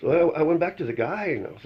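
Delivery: normal talking voice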